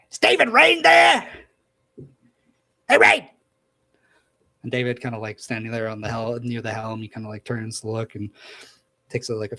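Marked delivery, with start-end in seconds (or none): 0.1-3.3 booming megaphone voice. Acted. Little man calls David through a megaphone, booming. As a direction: call out across the deck like a bullhorn. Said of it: "another voice from that little man, uh, kinda boom out as he starts talking through this megaphone".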